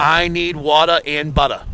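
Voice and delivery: slow Boston accent demo. Shown as a demonstration. Co-host giving a phrase to repeat, slow Boston accent. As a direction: model it slowly in Boston. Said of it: "Like this, ready?".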